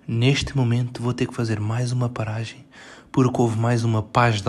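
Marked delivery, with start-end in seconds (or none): none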